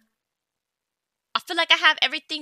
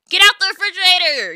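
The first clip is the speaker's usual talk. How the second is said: angry yell; 4 semitones higher, 9 dB louder, faster than usual. Real, not acted. Yelling at Sim at fridge.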